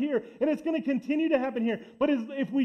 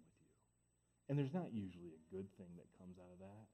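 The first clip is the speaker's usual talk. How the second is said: hushed sermon; 10 semitones lower, 16 dB quieter than usual. Acted. Pastor warning the church softly about little sins, hushed. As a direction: sink the voice right down to a near hush.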